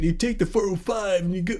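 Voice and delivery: softly put-on California accent; lightly mock a drawn-in California accent; Californians skit accent. Host quoting the Californians skit's freeway directions, mock California accent. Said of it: "I'm starting to like the Californians".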